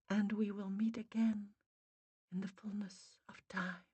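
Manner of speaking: soft farewell thinning to a whisper; fading away. A narrator's reading. Spirit mother saying goodbye, fading to a whisper. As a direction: let the voice drift off into nothing. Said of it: "The voice faded".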